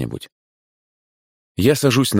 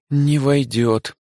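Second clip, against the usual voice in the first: kindly shop manager, higher; friendly older woman voice; директриса, добрая; 7 semitones higher, much slower than usual. A narrator's reading. Director voice. (добродушная директриса)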